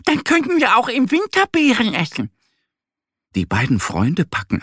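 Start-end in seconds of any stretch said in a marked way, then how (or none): 0.1-2.3 much higher little duck voice; squeaky duckling. A narrator's reading. Duck Annie planning winter treats, high squeaky voice. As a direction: chirp it like a small duck.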